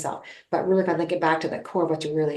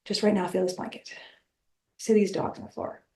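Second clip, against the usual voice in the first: hushed grounding; 7 dB quieter than usual. Retold or imitated. Grounding her fearful part with a whisper. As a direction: guide her gently into the present.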